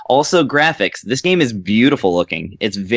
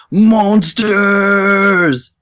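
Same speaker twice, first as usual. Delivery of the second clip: pig man shout; 8 semitones higher, 4 dB louder than usual. Retold or imitated. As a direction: bellow like a big brute. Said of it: "the pig goes".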